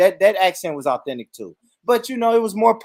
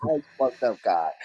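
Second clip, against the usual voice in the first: real belly laugh breaking over the words; laughing hard; 7 semitones lower, 4 dB quieter than usual. Real, not acted. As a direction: crack up and talk through it. Reacting in helpless laughter.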